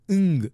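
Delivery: "Ng" held as a lone nasal sound; final consonant sound. Shown as a demonstration. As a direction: hum out "Ng" by itself. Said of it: "End, you pronounce it".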